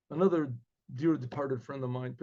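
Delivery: ordinary delivery, nothing marked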